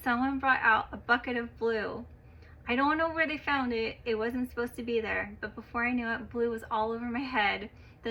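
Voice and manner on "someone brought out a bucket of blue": ordinary delivery, nothing marked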